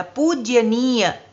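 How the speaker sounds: पूजनीय drawn out very slowly as the wrong form; slow demo of the wrong पूजनीय; पूजनीय said slowly as the mistake; wrong pronunciation. Shown as a demonstration. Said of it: "ये शब्द अशुद्ध हो गया".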